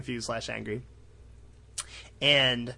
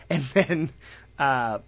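ordinary talk

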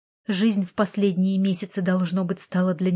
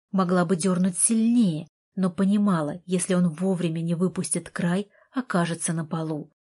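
usual speaking voice

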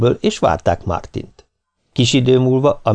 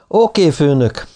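high, drawn-out casual reply; tossed-off remark; 6 semitones higher than usual. A narrator's reading. Greg throws a remark to Ken, offhand drawl. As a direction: toss it off, pitched high and slow. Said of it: "vetette oda".

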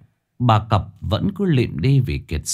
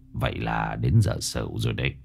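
much lower and softer mumble; muttering to himself; 11 semitones lower, 7 dB quieter than usual. Acted. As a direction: mutter it under your breath. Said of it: "Ông Cập lẩm bẩm". (lẩm bẩm một mình)

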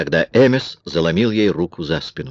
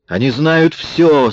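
high, slow angry exclamation; furious cry; 8 semitones higher, 4 dB louder, slower than usual. A narrator's reading. Kara bursts in on the men, furious. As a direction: cry it out in anger, drawn out. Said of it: "с бешенством закричала Кара".

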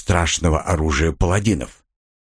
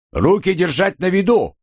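barked order; 9 semitones higher than usual. Acted. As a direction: bark it at the prisoner. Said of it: "рыкнул коренастый маг".

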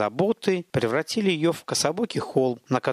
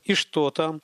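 ordinary delivery, nothing marked

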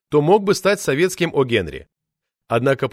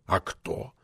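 stern boss; 6 semitones lower, 8 dB quieter than usual. Acted.

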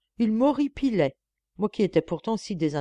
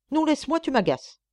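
higher-pitched irritated refusal, falling away; irritated rebuff; 8 semitones higher than usual. A narrator's reading. Annoyed wife pushing husband away, irritated rebuff. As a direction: push him off with an annoyed no. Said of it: "Celle-ci s'énervait. Elle le repoussa durement".

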